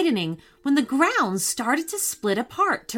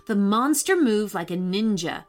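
ordinary delivery, nothing marked

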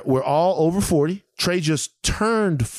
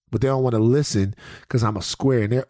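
normal talking voice

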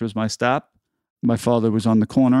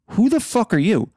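sharp high retold snap back, pitched up; angry retort; 12 semitones higher than usual. Firefighter snapping back at the young man who shoved him, angry retort. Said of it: "proceed to light this kid up".

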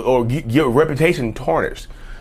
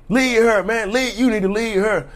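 higher-pitched, drawn-out intervening bystander; bystander stepping in; 7 semitones higher than usual. Acted. Bystander telling a man to leave her alone, raised-pitch protest. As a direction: call out to make someone back off. Voicing a bystander confronting you.

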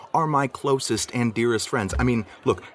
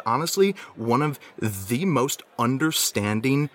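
usual speaking voice

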